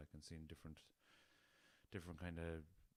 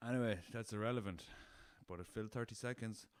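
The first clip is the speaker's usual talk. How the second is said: normal talking voice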